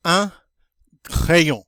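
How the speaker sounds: "Un crayon" with nasal un and French r; teacher modeling "Un crayon", careful French; model French word. Shown as a demonstration. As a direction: say "Un crayon" slowly and clearly as a model. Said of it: "I'm going to say, um, the word in French".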